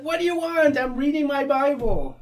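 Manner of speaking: loud, high shout back; irritated teenager shouting back; annoyed teen. Retold or imitated. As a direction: yell back, irritated at being interrupted. Teen snaps back at mother.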